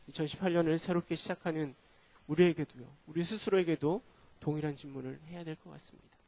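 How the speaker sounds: ordinary talk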